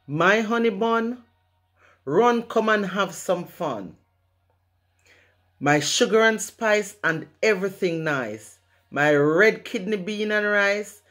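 rhythmic Jamaican poem recital over music; proud patois poem, performed with rhythm and swing; spoken word poem. Acted.

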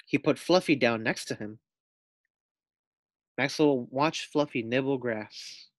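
plain reading voice

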